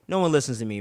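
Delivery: normal talking voice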